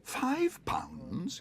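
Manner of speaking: high whispered disbelief; shocked whisper. A narrator's reading. Bear learns the price, whispered shock. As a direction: gasp it out. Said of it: "exclaimed Paddington".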